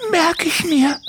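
sehr hohe, weinerliche Vogelstimme; weinerlich. Acted. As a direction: kleinlaut und den Tränen nah. Doris verspricht, weinerlich. (Doris verspricht Andi, hoch und weinerlich)

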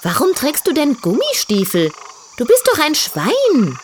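put-on high, vain young piglet voice; girly piglet voice. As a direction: play a proud little diva pig. Tütü teases.

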